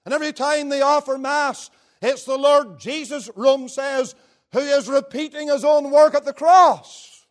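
high, loud pulpit delivery; full-force preaching. Real, not acted. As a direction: drive each phrase home, building to a loud finish.

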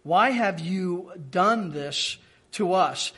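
ordinary delivery

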